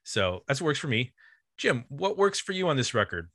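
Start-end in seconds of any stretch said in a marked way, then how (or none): none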